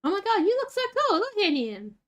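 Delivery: high, fast squealing gush; breathless high squeal at a cool new pet. Real, not acted. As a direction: rush it out in delight. Gushing at new puppy.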